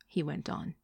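ordinary delivery

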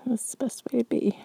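soft ASMR whisper. Acted. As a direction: breathe it to your partner.

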